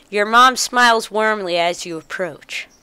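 usual speaking voice

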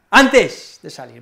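"Antes" loud and drawn out, rest dropping away; stress on Antes. Shown as a demonstration. Teacher demonstrates emphasis to learners, punching "Antes". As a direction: punch "Antes", then let "de salir" fall. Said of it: "Bueno, enfatizo esa palabra".

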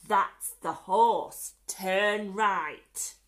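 loud, punched-out direction; emphatic robber. A narrator's reading. Len telling Hugh which way to go, loud and emphatic. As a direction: call the turn firmly.

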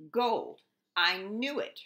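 gleeful king. Acted. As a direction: crow over the treasure. King exults over gold.

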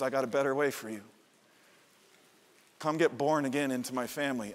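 lightly voiced Jesus, hushed and inviting; quiet voice of Jesus inviting; gentle voice of Jesus.

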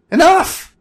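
high, very loud screech. Acted. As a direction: shriek to end the bickering. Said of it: "Bob screeched".

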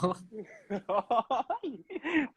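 laughing. Real, not acted. Host laughs at answer.

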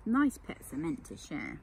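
ordinary delivery, nothing marked

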